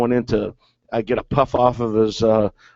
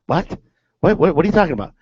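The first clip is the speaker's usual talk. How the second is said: higher-pitched, stammered disbelieving question; mock bewilderment; 4 semitones higher, faster than usual. Teammate told the manager isn't done, acted bewildered. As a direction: act out a baffled teammate. Teller voices teammate's puzzled reply.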